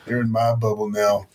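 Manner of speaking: ordinary delivery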